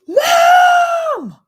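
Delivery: piercing high shriek of "Mom!"; child screaming mom. Retold or imitated. Kid shrieking at mom on the phone, piercing scream. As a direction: shriek it like a kid who can't wait. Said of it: "you're like".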